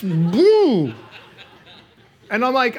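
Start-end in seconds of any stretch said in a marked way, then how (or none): 0.0-1.0 big, loud, drawn-out boom. Retold or imitated. Preacher telling how the jet ski tipped, loud boom. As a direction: punch out the crash as a sound effect.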